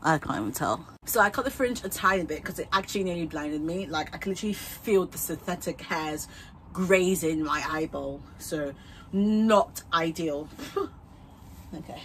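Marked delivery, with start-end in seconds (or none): none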